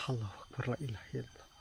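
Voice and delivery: frightened breathy whisper; scared whisper. Real, not acted. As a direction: whisper prayers in dread. Whispered prayer.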